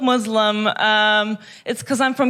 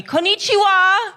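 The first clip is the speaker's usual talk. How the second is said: mimicked shout; 6 semitones higher than usual. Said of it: "someone shouted at me".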